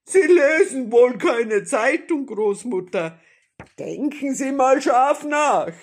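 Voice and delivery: loud, high-pitched jeering bandit; taunting robber. A narrator's reading. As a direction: bellow a mocking challenge.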